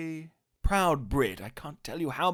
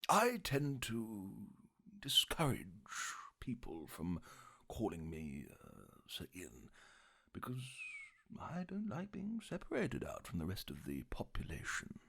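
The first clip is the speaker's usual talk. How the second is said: put-on hushed, stately elderly voice; Sir Ian McKellen impression; 3 semitones lower, 10 dB quieter than usual. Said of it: "No? Come on, I give you a big hint".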